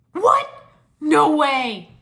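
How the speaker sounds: high, loud astonished cry; gasp of surprise. Acted. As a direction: burst out in disbelief. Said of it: "Cole gasped".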